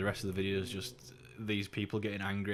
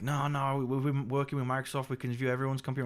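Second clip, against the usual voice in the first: a higher, louder mock caller voice; mock scam caller protesting; imitating the scammer; 6 semitones higher, 3 dB louder than usual. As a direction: parody the insistent phone scammer. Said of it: "he's all like".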